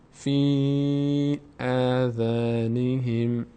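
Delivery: slow, drawn-out Quranic recitation. Shown as a demonstration. Tutor reciting the phrase slowly for students. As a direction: intone the phrase, lengthening every vowel. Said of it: "teacher reciting the verse slowly".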